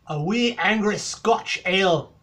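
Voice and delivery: a bad Scottish accent, loud and drawn out; Scottish impression. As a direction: bellow it like a proud Scotsman in a bad Scottish accent. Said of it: "my horrible, horrible impression of a Scotch, Scotsman".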